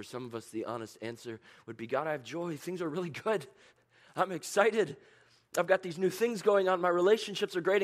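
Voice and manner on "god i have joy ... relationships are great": high, loud, bubbling joy; thrilled believer telling God it's all good; excited. Pretend. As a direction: gush about the good news. Excited answer voiced.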